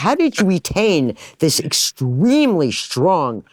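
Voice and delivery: high, drawn-out incredulous complaint; comically exasperated outburst; exasperated disbelief. Retold or imitated. Acted baffled complaint about accents.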